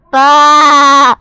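loud, high furious bellow; angry shout. A narrator's reading. Marvin trapped underground, loud angry bellow. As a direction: bellow it alone in the dark. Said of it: "shouted Marvin".

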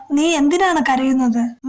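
plain reading voice

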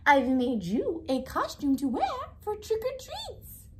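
high, singsong proud mom; doting mother. A narrator's reading. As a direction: announce your homemade present to your child. Mother presents her costume to Oscar.

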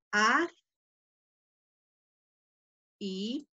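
Ad, i, ci, untur spaced by long pauses; syllable split. Shown as a demonstration. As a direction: split adiciuntur into slow separate syllables. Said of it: "la dividiría así". (adiciuntur dividida sílaba por sílaba)

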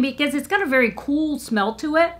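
usual speaking voice

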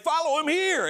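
high, bright plug; upbeat plug. Retold or imitated. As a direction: pitch it like an eager host. Interviewer plugging the account.

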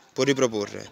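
usual speaking voice

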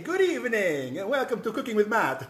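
high, drawn-out, exuberant host greeting; big sing-song welcome, far above normal pitch; put-on show intro. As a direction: open the cooking show in a high, playful put-on voice.

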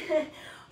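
short unvoiced laugh of relief; winded laugh after a hard set; breathless laugh. Real, not acted. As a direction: laugh out the last of your breath.

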